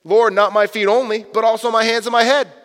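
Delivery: eager Peter. Acted. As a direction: Peter jumping all in. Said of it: "He says".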